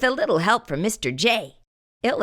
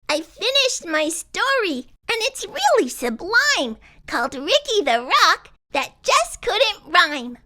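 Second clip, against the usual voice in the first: put-on higher, pleased young voice; proud Ricky; 9 semitones higher than usual. A narrator's reading. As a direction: present your work, proud and a bit shy.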